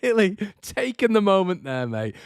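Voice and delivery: high sarcastic mock praise; mock self-mockery. Fan mocking himself after an awkward encounter. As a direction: send up your own awkward moment. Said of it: "It's like".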